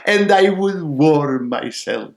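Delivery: loud little sparrow; confident sparrow. Acted. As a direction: answer firmly as the small bird. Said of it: "said the sparrow".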